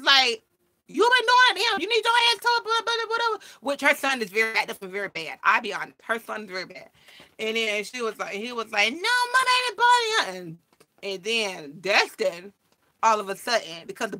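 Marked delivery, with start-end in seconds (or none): 0.9-3.4 high, loud put-on voice of a defensive mother; mock angry mother. Other mother snapping back. 8.9-10.6 high put-on kid's voice; imitating a child. Child denying to his mother.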